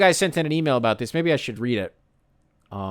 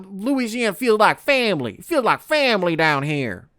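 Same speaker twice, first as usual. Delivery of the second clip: a hint of Louisiana accent aping the coach; mock Louisiana accent; 5 semitones higher than usual. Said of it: "What was that accent".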